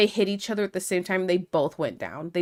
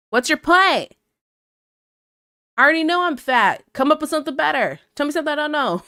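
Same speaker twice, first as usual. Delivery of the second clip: louder and higher, daring him to do better; loud comeback to a heckler; defiant loud retort; 7 semitones higher, 6 dB louder, faster than usual. Retold or imitated. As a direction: shout back, unbothered. Retorting to insult.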